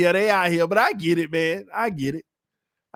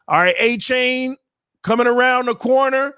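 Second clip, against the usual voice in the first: higher, louder running-play call; live play-by-play; 8 semitones higher, 5 dB louder than usual. Real, not acted. Commentator follows the back around the corner, play-by-play. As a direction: call the run as it happens.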